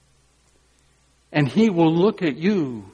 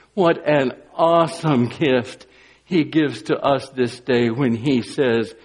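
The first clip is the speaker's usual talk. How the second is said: normal talking voice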